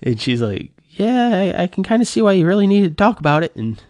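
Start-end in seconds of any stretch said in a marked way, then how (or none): 0.9-3.5 high, quick imitation of a wife; lightly voiced wife, pitched up and hurried; conceding wife. Wife conceding to husband why he needed to talk, conceding.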